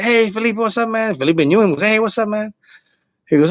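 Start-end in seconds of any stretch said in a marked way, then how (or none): 0.0-1.1 higher-pitched imitated hello; retold friendly greeting. Bar manager greeting a comic he knows, retold as a high-pitched hail. 1.8-2.5 higher-pitched imitated hello; retold greeting back. Comic greeting the bar manager he knows, retold at a higher pitch.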